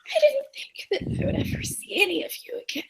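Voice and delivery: tearing up, whispered and raspy; hushed, emotional rasp; tearful raspy voice. Acted. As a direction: whisper it through a damaged throat, close to tears. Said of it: "she's, like, tearing up in it".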